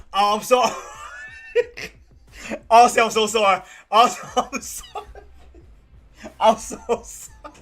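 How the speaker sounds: high, loud, breaking into laughter; helpless giggly sorry; laughing apology. Real, not acted. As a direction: apologize while cracking up. Laughing apology after celebrating too loudly.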